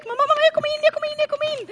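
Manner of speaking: high-pitched retold yell of joy; excited squeal. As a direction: shriek the good news to mother. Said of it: "jag bara skrek".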